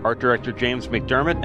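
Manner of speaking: usual speaking voice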